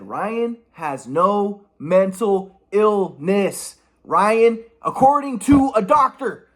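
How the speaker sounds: each word drawn out slowly; slow verdict. Retold or imitated. Quoting the doctor's verdict, slow and weighty. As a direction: announce the diagnosis slowly.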